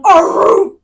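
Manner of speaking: loud mock howl. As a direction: howl like a yawning pet. Imitating snake noise.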